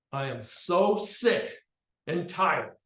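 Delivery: loud, high, slow at the start; furious mother. Retold or imitated. Mother storms into the school and yells at the teacher, loud and furious. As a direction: hammer out each word at the teacher.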